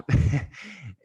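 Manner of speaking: high, choked laugh through tears; teary laugh. Real, not acted. Laughing off tears while telling the story of his mother, teary laugh. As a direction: let a wet laugh break out. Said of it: "I'm also getting emotional again".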